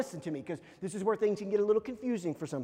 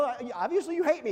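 mildly hammed-up hurt protest, higher; upset kid; 6 semitones higher, 4 dB louder than usual. Upset son to his parents, mock hurt accusation. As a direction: accuse them like a wounded child. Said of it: "I'm upset about this".

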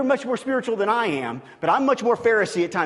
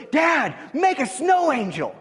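lightly voiced little boy, higher and slow; child's request; 6 semitones higher than usual. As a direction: play the eager four-year-old calling to dad. Son asking dad to play.

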